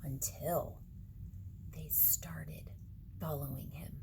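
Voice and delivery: hushed, eerie whisper; spooky whisper. A narrator's reading. Reading to kids in a spooky whisper. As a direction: breathe it like a secret. Spooky read-aloud build-up.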